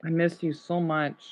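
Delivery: soft, low and wistful; emotional. Real, not acted. Looking at the closed store, quietly emotional. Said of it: "I'm gonna get a little emotional".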